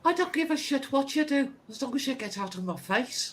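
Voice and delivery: high-pitched, impatient brush-off; dismissive guard. Acted. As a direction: send them away in a hammed-up high voice. Acted guard dismisses the players.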